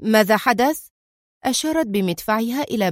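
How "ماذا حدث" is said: a touch of panic; mildly frightened, urgent asking; alarmed question. A narrator's reading. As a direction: ask it with a jolt of alarm. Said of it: "سألها قدري مذعورا".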